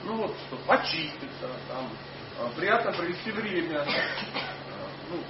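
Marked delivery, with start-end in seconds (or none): none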